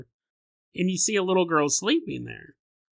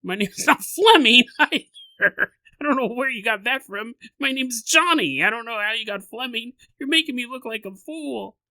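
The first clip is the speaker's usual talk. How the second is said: hammed-up ghost ranting, louder on Fleming; mock angry yelling; 6 semitones higher, 4 dB louder than usual. Ghost yelling at the girl to get out, mock angry rant. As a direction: play an indignant ghost whose name was got wrong. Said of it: "or just yelling".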